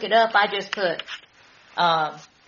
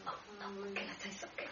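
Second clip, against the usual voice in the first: whisper; 22 dB quieter than usual. Real, not acted. Eager interruption.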